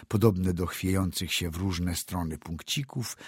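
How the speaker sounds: plain reading voice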